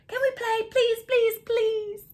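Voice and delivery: high pleading puppy voice; begging puppy. Acted. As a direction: beg sweetly like a puppy. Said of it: "they asked".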